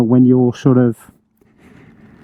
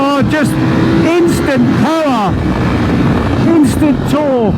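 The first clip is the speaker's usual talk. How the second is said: excited; 7 semitones higher than usual. Real, not acted. Thrilled rider shouting over engine.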